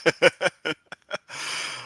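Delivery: high burst of delighted laughter; real laugh. Real, not acted. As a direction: genuine amused laugh. Reacting to a debate clip.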